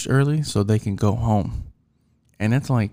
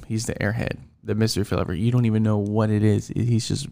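normal talking voice

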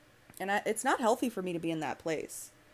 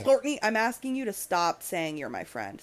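normal talking voice

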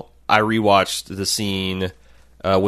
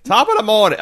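put-on a lightly done Irish accent, pitched up; a quick mock Irish greeting; hint of a stage Irish brogue; 12 semitones higher, 3 dB louder, faster than usual. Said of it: "he speaks in an Irish accent".

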